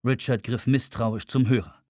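usual speaking voice

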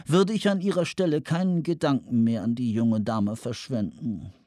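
fuchshafte, ölige Anruferstimme; ölig, fies. Acted. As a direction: sprich ölig und mit gedehnten Worten. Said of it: "Der Tonfall war ölig, fies, fuchshaft". (öliger, fuchshafter Tonfall)

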